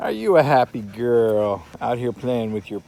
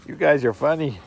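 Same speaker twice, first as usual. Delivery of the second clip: brighter and louder, delighted; amused; 5 semitones higher, 6 dB louder than usual. Real, not acted. Owner delighted by the playing puppies, amused. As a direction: lift it up, tickled by the puppies.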